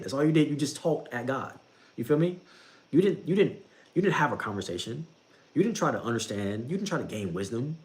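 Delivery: normal talking voice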